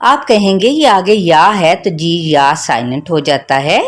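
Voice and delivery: ordinary talk, nothing marked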